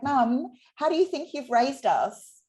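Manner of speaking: gently mock her mum's doubt; lightly voiced teasing daughter. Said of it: "she was like".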